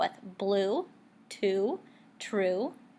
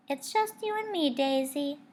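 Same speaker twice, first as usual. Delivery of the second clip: a touch higher, wistful; teacher to Daisy, a little higher; lightly voiced teacher; 5 semitones higher than usual. A narrator's reading. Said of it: "she said".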